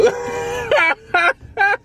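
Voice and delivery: high, loud cackling shriek of laughter. Real, not acted. Gamer cracks up telling the story, high loud shrieking laugh.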